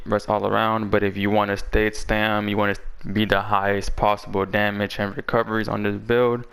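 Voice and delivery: ordinary talk, nothing marked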